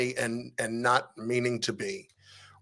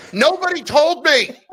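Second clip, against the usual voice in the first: cracking up mid-quote, pitched way up; laughing disbelief; 9 dB louder than usual. Retold or imitated. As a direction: laugh through the words in mock outrage. Retold exasperating exchange with Fuch.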